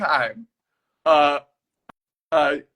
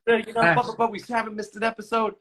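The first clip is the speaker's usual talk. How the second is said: excited fan; 9 semitones higher, faster than usual. Retold or imitated.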